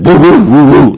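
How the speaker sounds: high, loud barking woofs, each falling; imitated dog bark. Dog asking its owner for a car ride, loud falling woofs. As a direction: woof like an eager dog begging. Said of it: "I want to be taken for a car ride".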